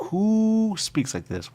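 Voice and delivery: high, slow exasperated question; incredulous. Acted. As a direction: drag it out in disbelief. Mocking odd speech.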